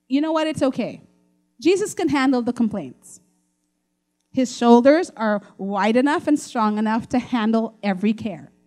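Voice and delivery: usual speaking voice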